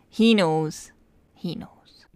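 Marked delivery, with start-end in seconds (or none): 1.3-2.0 hushed, whispered aside; very soft, voiceless echo; knowing whisper. Real, not acted. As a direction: whisper it as if in on the secret. Knowing repeat, dropped to whisper.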